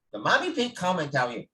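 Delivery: normal talking voice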